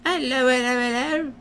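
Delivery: high-pitched playful calling hello; high sing-song hello. Acted. Card character calling out hello, high and playful. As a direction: sing out a greeting in a lifted cartoon voice.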